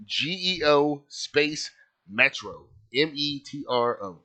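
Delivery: slow, letter-by-letter spelling. Real, not acted. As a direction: spell it out slowly for someone searching. Spells car name for searching.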